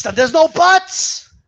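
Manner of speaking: high, slow, drawn-out put-on voice; cartoonish scolding; stretched mock voice. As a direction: drag out no buts to mock them.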